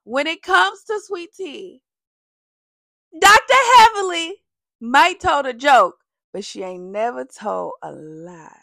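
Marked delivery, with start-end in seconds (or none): 3.2-6.0 preachy proclamation. Real, not acted. Building to the punchline.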